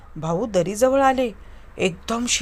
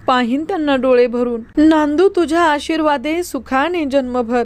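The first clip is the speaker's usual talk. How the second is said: desperate prayer; 4 semitones higher than usual. Acted. Mother praying before the gods for her husband, desperate plea. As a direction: beg the goddess with a breaking heart. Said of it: "आईने पदर पसरला".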